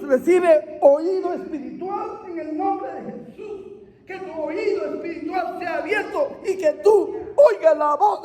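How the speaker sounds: impassioned preacher praying over the crowd; fervent prayer. Real, not acted. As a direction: pray it out with rising fire. Prays over the congregation.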